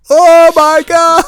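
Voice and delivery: high, slow, drawn-out mock shock; mock shocked gasp. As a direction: stretch it out like you can't believe it. Acting out both reacting at once.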